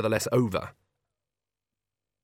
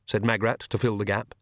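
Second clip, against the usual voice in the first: plain reading voice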